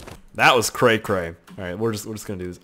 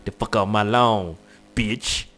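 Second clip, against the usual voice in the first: lightly put-on low, quieter tough voice; mock tough talk; 4 semitones lower, 4 dB quieter than usual. Warning someone off the lawn, mock tough talk. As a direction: play a low, mock-threatening character. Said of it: "He's like".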